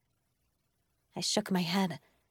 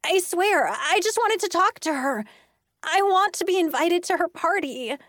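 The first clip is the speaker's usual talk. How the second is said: high, whiny, upset protest; distressed, high-pitched begging; tearful pleading; 9 semitones higher, 4 dB louder than usual. A narrator's reading. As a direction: plead your case, close to tears. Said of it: "her eyes filling with tears".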